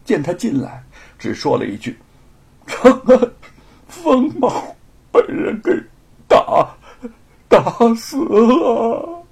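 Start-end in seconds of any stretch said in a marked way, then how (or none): 2.7-9.2 higher, louder, stammering, very slow; 悲痛哽咽，说不下去; broken with grief. Acted. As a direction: barely get the terrible news out. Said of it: "就再讲不出话来了".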